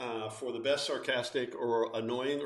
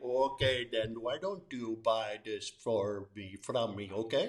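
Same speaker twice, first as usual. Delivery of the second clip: slow, lower-pitched Indian accent; 4 semitones lower, 4 dB quieter, slower than usual. Shown as a demonstration. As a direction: put on an Indian accent, haggling slowly like a vendor. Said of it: "I'm pretty good at Indian".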